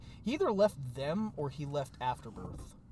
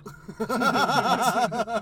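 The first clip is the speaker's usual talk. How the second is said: loud laugh; 8 semitones higher, 11 dB louder than usual. Real, not acted. Laughing at own impression.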